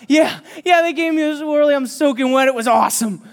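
put-on a giddy boy thrilled by his swirly; gleeful kid. Teacher voices soaked Darius, gleeful and loud.

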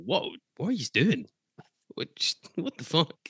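high, swooping, baffled questioning; exasperated disbelief. Real, not acted. Host despairing at Park Thistle's cup collapse, exasperated disbelief. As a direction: stammer it out high-pitched, lost for words.

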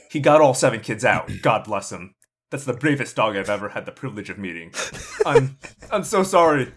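a cop losing his composure at the end; grieving officer breaking down; officer near tears. Acted. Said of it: "the officer can't hold back his tears".

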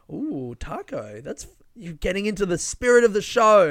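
thrilled by the costume; excited comic. Retold or imitated. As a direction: fellow comic cheering the pirate costume. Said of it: "He's like".

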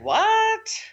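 shocked what. Retold or imitated. Client says tech ordered restore.

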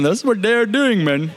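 playfully put-on Indian accent; mock Indian accent. Preacher joking about his own slip, mock Indian accent. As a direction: play up an accidental Indian lilt as a joke. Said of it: "why I sounded Indian".

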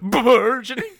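high, loud put-on echo of a funny pronunciation; mocking imitation. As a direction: overdo the odd-sounding word to tease. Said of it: "just funny how you said it".

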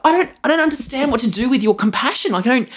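high, bewildered rambling; flustered. Retold or imitated. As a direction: flounder for words on stage. Retelling stage confusion to audience.

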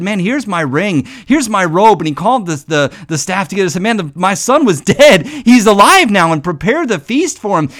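the father calls to the staff, his voice rising in joy; joyful father. Retold or imitated. Father welcoming his lost son, rising joy. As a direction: lift the voice as the son comes home.

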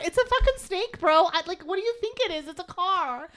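high, animated fed-up retort; exasperated parent, played up for laughs; retold exasperation. Retelling what she told her kid.

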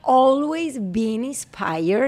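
slow, deliberate English on request; slow careful English. Real, not acted. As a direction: spell it out word by word, a bit anxious. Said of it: "In English, please".

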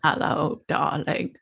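soft, purring vintage film-star voice; Katharine Hepburn voice. Pretend. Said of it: "Katie is behind the bar".

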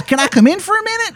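fast, very high Baltimore voice; bad Baltimore accent. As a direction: rattle it off high in broad Baltimore. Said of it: "this Baltimore accent".

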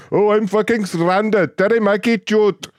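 high nasal mock of the caller; mocking whine. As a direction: drag it out in a high whiny voice like a spoiled complainer. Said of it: "you're stuck in Florida like it's the end of the world".

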